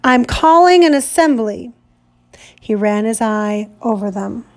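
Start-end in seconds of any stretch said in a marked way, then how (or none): 0.0-1.7 higher, louder summons; raised firm call; loudly. Acted. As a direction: call it out over them.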